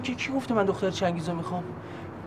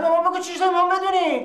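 pleading protest; 7 semitones higher than usual. Acted. Pleading to know why.

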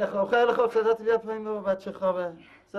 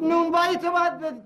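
angry shout; 11 semitones higher than usual. Acted. Brother shouts at brother about the bakery share, furious. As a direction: hurl it at him.

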